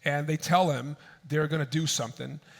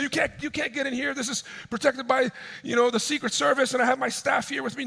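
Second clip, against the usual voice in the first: high, hurried mock protest; acted-out agitated objection; flustered protest; 8 semitones higher, faster than usual. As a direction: imitate a flustered man refusing entry. Acted protest at strangers entering.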